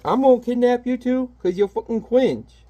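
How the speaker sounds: lightly hammed comic book bad guy; villain voice. Reading the villain's line aloud in a high sneer. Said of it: "He's like".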